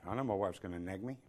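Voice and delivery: ordinary talk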